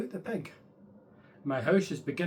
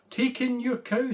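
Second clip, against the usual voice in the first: higher, louder confident advice; wise old man; 8 semitones higher, 4 dB louder than usual. Acted. Wise old man telling the lady to take her hen. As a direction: announce the plan brightly.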